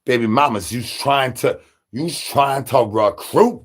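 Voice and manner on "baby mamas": ordinary talk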